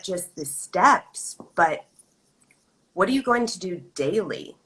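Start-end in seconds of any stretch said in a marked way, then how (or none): none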